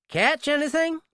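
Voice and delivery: friendly small-town nosiness; drugstore clerk. Acted. Drugstore clerk chats up the stranger buying cigarettes.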